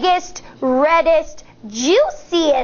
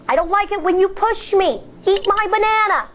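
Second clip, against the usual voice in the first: fast; 4 semitones higher, faster than usual. Shown as a demonstration. Rapid demo list.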